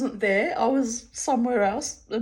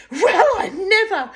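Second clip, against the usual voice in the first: higher, louder huff with a laugh; mock indignant; 11 semitones higher, 9 dB louder than usual. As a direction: play the offended lady, amused. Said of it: "And she says".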